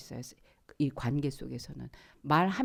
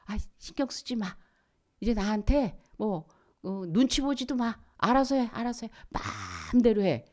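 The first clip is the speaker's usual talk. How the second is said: acted exasperated, high-pitched brush-off; fed-up parent; 5 semitones higher than usual. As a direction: wash your hands of the child, irritably. 화나서 포기한 부모 흉내. (짜증 내며 내버려 두는 부모 말투)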